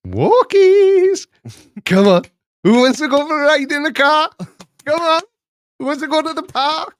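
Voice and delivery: very high, loud sing-out to a dog; excited dog-owner call. Acted. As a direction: whip the dog up for walkies. Mimics calling an excited dog.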